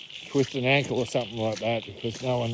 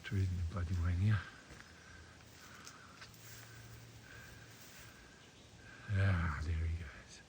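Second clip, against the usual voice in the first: hushed voice; 6 semitones lower, 14 dB quieter than usual. Real, not acted. Goanna moving off.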